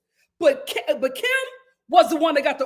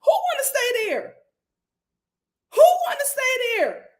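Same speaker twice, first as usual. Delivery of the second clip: indignant; 10 semitones higher than usual. Real, not acted. Repeated indignant rhetorical question.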